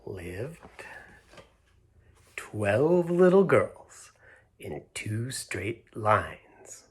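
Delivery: slow, lilting read-aloud with hushed pauses; storybook reading. A narrator's reading. Storyteller reads the opening rhyme to children slowly. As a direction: savour each rhyming line for little listeners.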